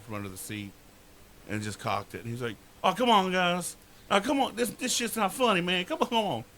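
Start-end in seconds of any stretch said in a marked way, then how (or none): 2.8-6.4 panicked pleading. Retold or imitated. Friend panics at cocked gun.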